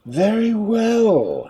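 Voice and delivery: slow, mildly sulky shark. Acted. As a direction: give in with a sulk. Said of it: "said the shark sulkily".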